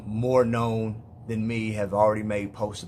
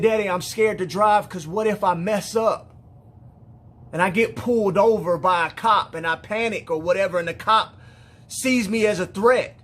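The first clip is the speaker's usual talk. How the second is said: higher, louder, distressed retelling of a scared daughter; imitating frightened pleading, raised pitch; retold tearful fear; 6 semitones higher, 5 dB louder than usual. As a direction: relay a daughter's panic with rising urgency. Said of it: "she's crying and tears are rolling down her face".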